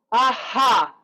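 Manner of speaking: loud breathy aha with a released ha; grounded vocal warm-up aha; deep-breath aha. Shown as a demonstration. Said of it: "Take a deeper breath".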